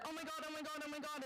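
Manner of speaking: high, panicked run of words; mock scream. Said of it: "the girl fish screamed and said".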